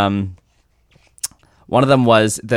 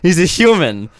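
higher, mocking incredulous rant; exasperated disbelief; 7 semitones higher, 3 dB louder than usual. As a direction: sound fed up with the movie. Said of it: "And I'm like".

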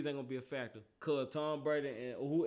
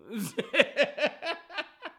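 high amused laugh; 8 semitones higher, 4 dB louder than usual. Real, not acted. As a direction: laugh at your own boast. Laughs at the bets.

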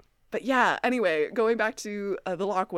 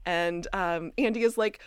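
ordinary talk, nothing marked

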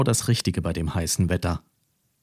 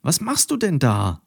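higher, dismayed question; shocked question; 7 semitones higher than usual. A narrator's reading. Mathilda questions Carola in the pool, higher and dismayed. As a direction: ask in disbelief. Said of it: "rief sie entsetzt".

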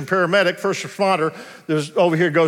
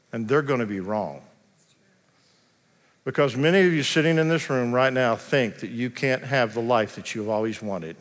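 hushed sermon appeal; 6 semitones lower, 4 dB quieter than usual. Acted. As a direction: draw the room in close and speak gently. Soft closing appeal.

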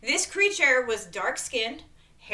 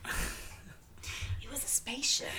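whisper; 12 dB quieter, much slower than usual. Acted. Dramatic reveal whisper.

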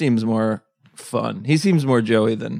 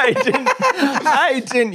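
mock high voice; 12 semitones higher, slower than usual. Mocking the quote, laughing.

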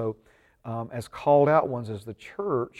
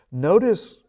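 emphatic notice; 7 semitones higher, 9 dB louder than usual. Real, not acted. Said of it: "emphatic call to notice".

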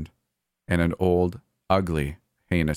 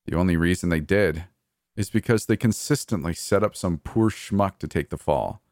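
ordinary delivery

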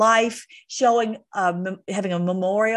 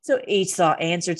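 ordinary talk

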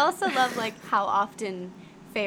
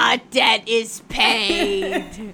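slow, louder, laughing mock intonation; mock wraith echo; 3 semitones lower, 7 dB louder, much slower than usual. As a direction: echo it slowly, laughing through it. Echoing wraith line laughing.

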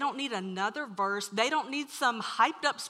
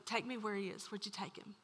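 quiet request; 2 semitones lower, 11 dB quieter than usual. Retold or imitated. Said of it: "And Jesus says to them".

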